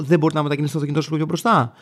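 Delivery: rattled off fast, mirroring her question; fast tit-for-tat retort. Retold or imitated. Driver fires her question straight back at her, fast. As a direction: throw her own words back at her, quickly. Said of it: "Λέω".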